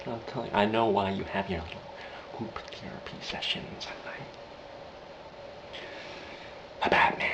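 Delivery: soft, whispery villain; Joker impression. Joker explaining the mobsters' night meetings, whispery menace.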